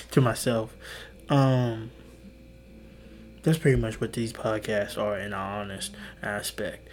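ordinary delivery

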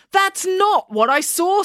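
high, slow, emphatic contradiction; insistent objection. A narrator's reading. April disputes what the others say, insistent objection. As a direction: argue back, affronted.

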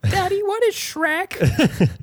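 put-on high, slow little-kid voice asking daddy. Host imagines his son asking about Shrek, child voice. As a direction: play a small curious child.

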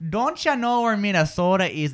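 Minnesota accent with long O's; Upper Midwest accent. Shown as a demonstration. Host models the Upper Midwest accent for Natalie. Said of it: "in the Upper Midwest accent".

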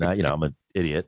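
ordinary talk, nothing marked

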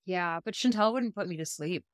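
usual speaking voice

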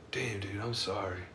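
hushed, sheepish apology; quiet mock apology. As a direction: drop it to a soft, sorry murmur. Other side apologising, retold.

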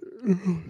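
audible real yawn. Real, not acted. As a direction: let out a long yawn. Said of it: "sorry, I yawned".

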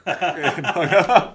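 ordinary talk, nothing marked

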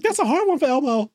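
lightly high Elmo voice; a hint of puppet squeak. Friend retelling Elmo's reaction, lightly high puppet voice. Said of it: "And he's like".

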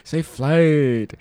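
slow stretched safe flight; drawn out. Shown as a demonstration. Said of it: "what is the elongation?".

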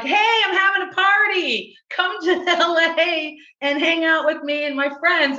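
high-pitched mock party invite; imitated excited invitation. Imitating friend inviting her to party.

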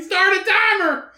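shrill mock order to a phone; high bossy phone command. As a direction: bark it at a voice assistant.

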